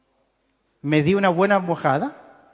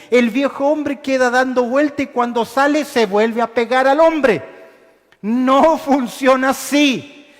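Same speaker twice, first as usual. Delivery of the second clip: forceful preaching; 6 semitones higher, 4 dB louder than usual. Acted. Preacher building to full force.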